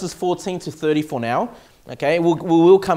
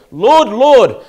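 mock pleading cry; 4 semitones higher, 9 dB louder than usual. Quoted pleaders at judgment.

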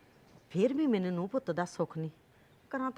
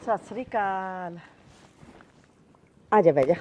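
loud welcome; 12 dB louder than usual. Acted. Loud welcome to visiting friend.

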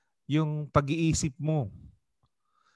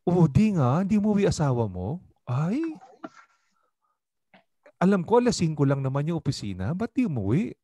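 slightly higher, louder, fast, feigned concern; meddling friend; 3 semitones higher, 4 dB louder than usual. Retold or imitated. Pastor imitating a friend who plants doubt about a spouse. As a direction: plant doubt while acting surprised. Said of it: "Yung tipong".

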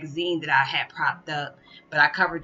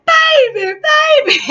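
very high, loud wailing sob; ugly crying; 14 dB louder, much slower than usual. Retold or imitated. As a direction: wail it like a father breaking down. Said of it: "my dad was ugly crying".

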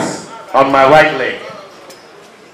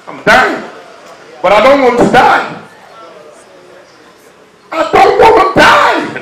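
desperate plea; 11 semitones higher than usual. Retold or imitated. Retold plea after axe attack.

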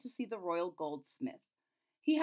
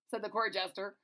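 ordinary delivery, nothing marked

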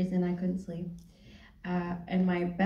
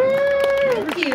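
much higher, louder squeal of thanks; delighted thank-you; 8 dB louder than usual. Real, not acted. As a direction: squeal it back to the cheering crowd. Answering applause for [name].

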